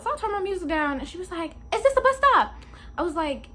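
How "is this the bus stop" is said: high, louder voice for the lady; lightly voiced lady at bus stop; lady asking. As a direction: play the stranger asking, pitched up.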